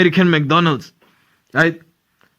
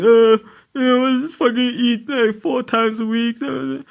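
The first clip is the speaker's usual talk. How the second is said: lightly higher put-on voice of a McDonald's customer; mock interviewee, pitched up; 6 semitones higher than usual. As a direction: voice the interviewed guy, a bit higher.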